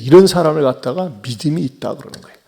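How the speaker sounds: normal talking voice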